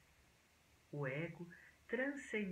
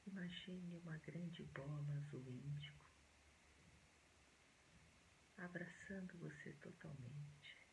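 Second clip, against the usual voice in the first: soft, hushed meditation voice with long pauses; quiet, unhurried relaxation guidance; guided meditation; 14 dB quieter than usual. Acted.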